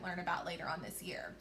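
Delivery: quick whispered aside; hushed teacher aside. Real, not acted. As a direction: drop to a fast whisper, like sharing a secret.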